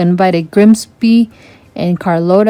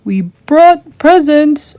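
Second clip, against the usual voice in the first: shouted; 8 semitones higher, slower than usual. A narrator's reading. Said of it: "Aquata shouted".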